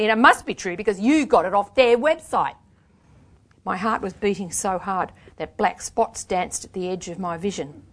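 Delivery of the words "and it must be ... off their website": raised, louder biting mockery; savage sarcasm. A narrator's reading. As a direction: pretend to agree with heavy scorn. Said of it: "I said savagely".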